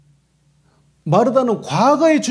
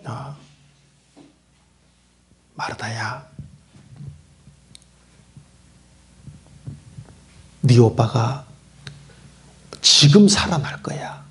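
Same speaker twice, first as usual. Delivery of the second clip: hushed, lower gentle promise; tender whisper; 7 semitones lower, slower than usual. Acted. Jesus to grieving Martha, whispering a promise. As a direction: breathe it like a secret hope.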